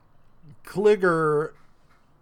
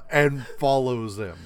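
ordinary talk, nothing marked

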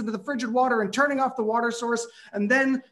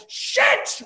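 very high, shrieking yelp; top-of-lungs curse; 3 dB louder than usual. Retold or imitated. Narrator bashes shin a second time, shrieking curse. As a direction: shriek it as the same shin hits again. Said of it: "I yelled out at the top of my lungs".

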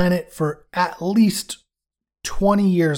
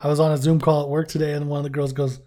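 usual speaking voice

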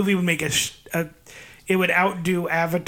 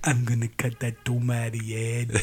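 lower, deep put-on action hero voice; mock Arnold; 5 semitones lower, 4 dB quieter than usual. Friend playing Arnold threatening Satan in a deep action voice. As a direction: menace like a muscle-bound movie hero.

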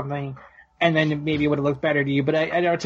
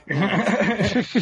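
laughter; 3 semitones higher than usual. Real, not acted. Laugh after joke.

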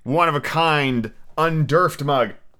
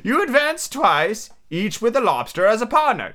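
crying out; 8 semitones higher than usual. A narrator's reading. Griffin explaining the dance to Alice, crying out. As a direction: cry the dance steps out high. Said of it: "cried the griffin".

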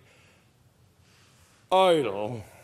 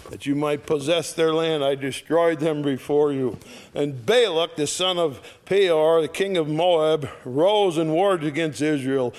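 scripture reading; 4 dB louder than usual. Acted. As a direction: proclaim the verses to the congregation. Reads scripture aloud.

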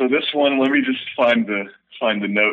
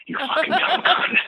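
vicious inner snarl. Acted. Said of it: "And he goes".

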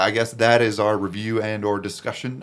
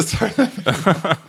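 laughing; 8 semitones higher, 4 dB louder than usual. Real, not acted. Laughing apology.

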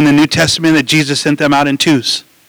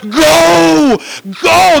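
urgent shout; 9 semitones higher, much slower than usual. Retold or imitated. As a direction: urge the runners on with all you have. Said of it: "He's saying".

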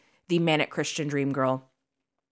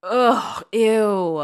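disgusted; 5 semitones higher, 5 dB louder than usual. Real, not acted. Disgusted at Jesus comparison.

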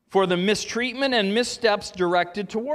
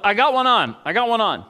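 raised, louder fisherman's call; acted yell; 5 semitones higher, 6 dB louder than usual. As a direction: call out the catch to everyone on the pier. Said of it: "So a guy yells out".

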